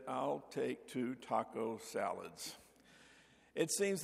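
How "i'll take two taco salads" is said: quiet, slow and sheepish. Retold or imitated. Customer realizing he's at the wrong drive-thru, sheepish and quiet. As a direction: mumble it, embarrassed at the mistake. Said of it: "So I sheepishly said".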